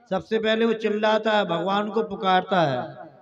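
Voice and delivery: ordinary delivery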